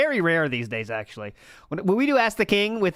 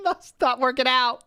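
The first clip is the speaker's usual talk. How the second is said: high, slow mock plea; mock pleading; 5 semitones higher than usual. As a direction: beg in a put-on voice.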